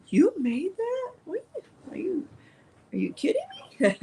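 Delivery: high, amazed squeal of disbelief; imitating amazed friend. Friend amazed at handmade towel, high disbelieving squeal. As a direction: mimic a friend who can't believe it. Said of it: "Heidi's like".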